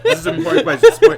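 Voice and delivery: high, loud through laughter; laughing. Real, not acted. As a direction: ask while cracking up. Laughing while talking.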